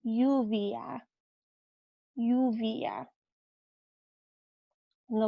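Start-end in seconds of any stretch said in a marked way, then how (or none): 0.0-3.1 lluvia said YOO-vya, LL like Y. Shown as a demonstration. Teacher naming rain in Spanish. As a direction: say lluvia starting with Y. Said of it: "This is the word for rain".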